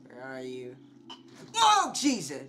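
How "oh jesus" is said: high, loud yelp falling off; startled cry. Real, not acted. Player caught off guard in game, startled. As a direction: cry out at a sudden scare.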